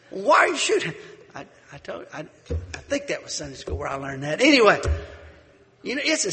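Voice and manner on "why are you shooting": louder, high exasperated question. Retold or imitated. Stranger pressing the feuding neighbor, loud and exasperated. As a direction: demand it in disbelief.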